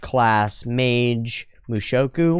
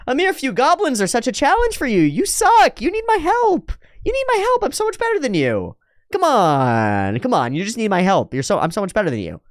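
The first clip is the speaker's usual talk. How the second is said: much higher, pompous show-off voice; mocking high braggart; 11 semitones higher than usual. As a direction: squeal the boasts like a puffed-up brat. Arrogant mage belittling Eris.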